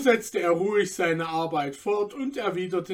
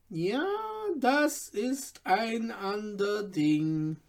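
put-on slow, softer old tailor weighing it up; a thoughtful old man, slow and softer; deliberate; 3 semitones higher, 4 dB quieter, slower than usual. A narrator's reading. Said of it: "sagte Babadul bedächtig". (bedächtig, langsam und leiser)

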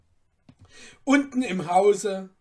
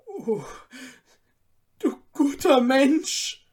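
high, loud cry through tears; tearful exclamation; 7 semitones higher, 6 dB louder than usual. Acted. Student overcome thanking the lender, tearful cry. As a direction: burst out weeping with gratitude. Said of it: "rief er aus".

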